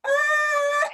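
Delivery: long, very high mock scream; imitated scream. Lecturer replaying the film woman's cry, imitated scream. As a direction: hold a high imitation shriek. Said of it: "you hear the woman going".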